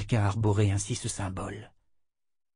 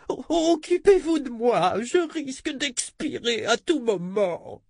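whiny voice. Acted. Haughty noblewoman complains, same shrill voice.

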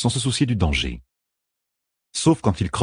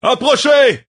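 high, loud battle bellow; 7 dB louder, slower than usual. A narrator's reading. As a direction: bellow a challenge at the enemy. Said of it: "beugla le lieutenant". (beuglement de défi, aigu et puissant)

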